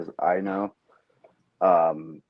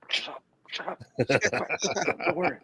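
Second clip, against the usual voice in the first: frantic half-whispered shushing; urgent hushing; 5 semitones higher, 5 dB quieter than usual. Retold or imitated. Dad hushing his son in front of the store associate, urgent whisper. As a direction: hiss it hurriedly under your breath.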